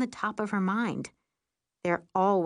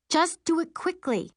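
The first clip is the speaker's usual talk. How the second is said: urgent plea; 10 semitones higher, 3 dB louder than usual. A narrator's reading. Urgent plea on wobbling broom.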